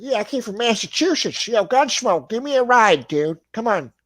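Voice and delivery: high, loud Massachusetts friend voice; Massachusetts accent. Retold or imitated. Imitating a Massachusetts friend asking for a ride, high and loud. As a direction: do your old Massachusetts buddy asking for a ride. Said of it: "I said it like that 'cause I had a friend".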